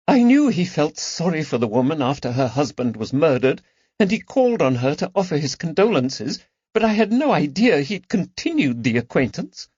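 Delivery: high, trembling wounded voice; hurt holding back tears. Acted. Lady Caroline recalling his betrayal to friends, a touch trembling.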